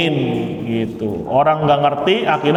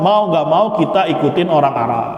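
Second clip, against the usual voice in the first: ordinary talk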